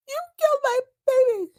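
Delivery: high, sobbing, shrieking accusation; furious grieving mother. Retold or imitated. Recapping Alexis screaming at Blake, high sobbing wail. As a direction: wail it like a mother who lost her child. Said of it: "She's so pissed".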